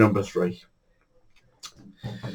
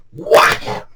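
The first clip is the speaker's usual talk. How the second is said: whack. Retold or imitated. Imitating the feeder being cast.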